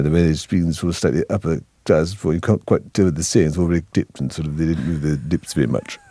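mumbled, swallowed upper-class English; royal upper-class accent. Retold or imitated. Comic mocking how the royals speak, royal upper-class accent. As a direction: imitate a clenched royal accent, words half swallowed. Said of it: "the way the royals speak is".

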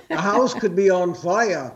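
lightly done Yiddish grandmother voice; a hint of a kvetching bubbe.

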